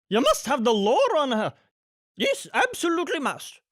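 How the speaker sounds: high, loud insistent cry. A narrator's reading. Nannies urge Mister Dearly, high insistent cry. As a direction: urge him eagerly. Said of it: "cried the nannies".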